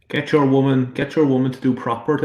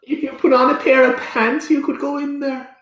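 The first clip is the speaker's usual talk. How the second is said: nagging wife voice; 12 semitones higher than usual. Pretend. Mocking J J in mum voice.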